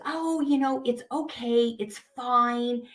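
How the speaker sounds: high, small voice of self-persuasion; little inner voice. Acted. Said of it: "that little voice goes".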